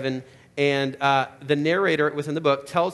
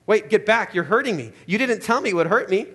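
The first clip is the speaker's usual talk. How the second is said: higher, louder cry of pain and panic; pained alarm; 5 semitones higher, 4 dB louder than usual. Acted. Ghost recoiling from the angel, in pained alarm. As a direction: pull away, it hurts.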